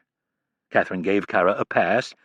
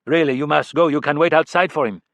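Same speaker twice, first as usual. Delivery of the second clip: put-on cold French-accented assistant, louder and higher; thick French accent; 6 semitones higher, 5 dB louder than usual. A narrator's reading. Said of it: "in a thick French or French-Canadian accent".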